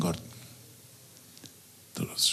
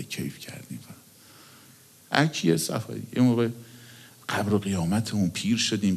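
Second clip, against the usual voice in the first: usual speaking voice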